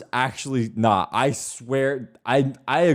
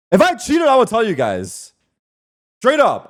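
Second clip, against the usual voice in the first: excited; 10 semitones higher, 6 dB louder than usual. Real, not acted. Insisting he did not cheat.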